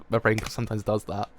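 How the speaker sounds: ordinary delivery, nothing marked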